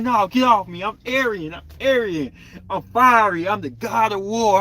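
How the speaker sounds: mock toddler bravado; mock tough baby. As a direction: play a baby insisting he's fierce. Acted Aries baby protesting.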